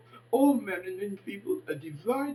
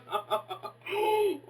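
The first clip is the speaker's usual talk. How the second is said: high laugh; 9 semitones higher than usual. Real, not acted. Laugh after rant.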